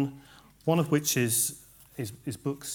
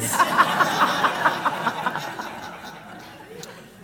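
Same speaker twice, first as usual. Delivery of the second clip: burst of laughter; 7 dB louder than usual. Real, not acted. Audience laughs at joke.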